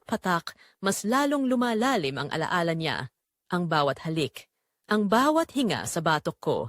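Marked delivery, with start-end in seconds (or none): none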